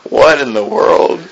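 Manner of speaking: slow, stunned whisper; hushed onlooker's bewilderment; stunned thought. Acted. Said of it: "everybody's thinking".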